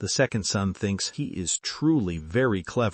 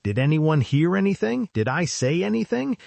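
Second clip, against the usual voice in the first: mild narrated shout at the onlookers; 6 semitones higher than usual. A narrator's reading. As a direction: call it out to everyone, daring an answer.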